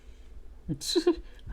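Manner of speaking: breathy high giggle. Real, not acted. Laugh before teacher impression.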